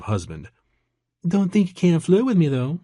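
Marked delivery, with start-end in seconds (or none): 1.3-2.8 high, flirty Georgia waitress; amused Georgia accent. A narrator's reading. Married waitress inviting flirting, amused Georgia accent. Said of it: "Kelly said with a laugh".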